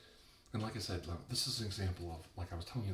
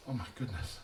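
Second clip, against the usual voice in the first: usual speaking voice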